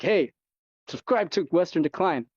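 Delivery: quick hint of a YouTuber's pitch; imitated YouTuber plug. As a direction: imitate a creator asking for subscribers. Quotes the YouTuber's sign-off.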